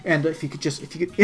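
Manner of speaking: imitated hushed plea out the side of the mouth; furtive aside. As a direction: drop to a secretive undertone. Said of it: "he's just talking to the side".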